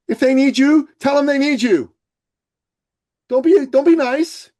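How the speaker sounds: forceful urging. Real, not acted. Urging listeners to assert themselves.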